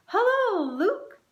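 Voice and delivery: high, loud, bright sing-song hello; a whole chorus calling out his name happily; cheerful greeting. A narrator's reading. Butterfly family greeting Luke, high and excited.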